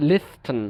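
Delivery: লিস্টেন with an audible t between s and n; slow লিস্টেন, t kept in; T pronounced. Shown as a demonstration. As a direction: sound the usually silent t in লিস্টেন. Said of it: "উচ্চারণ করতে কষ্ট হচ্ছে".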